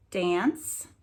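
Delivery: normal talking voice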